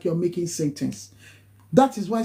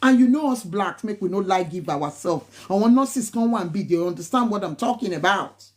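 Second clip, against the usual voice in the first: ordinary talk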